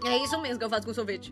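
usual speaking voice

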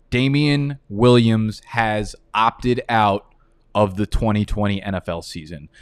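normal talking voice

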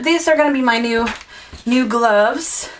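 ordinary delivery

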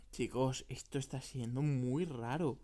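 low-pitched, grave mock dread; ominous unease. Gamer telling viewers this is weird, ominously. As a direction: say it low, like a mystery unfolding.

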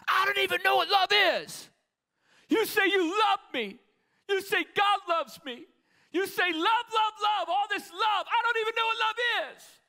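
high, loud full-force shout; furious, high-pitched yelling outburst; exploding in anger. Acted. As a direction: explode at the person who claims to love you. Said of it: "he exploded at me".

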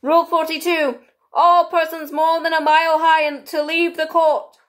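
high, loud proclamation; King reading out. A narrator's reading. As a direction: declaim a rule grandly and deliberately. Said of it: "read out from his book".

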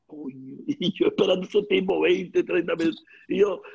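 usual speaking voice

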